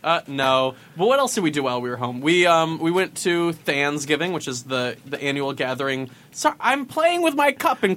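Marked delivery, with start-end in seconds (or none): none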